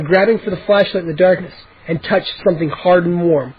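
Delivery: ordinary delivery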